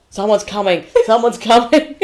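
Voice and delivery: loud, hammed-up announcement; mock urgent heads-up; excited alert. Acted alert during a retold gig.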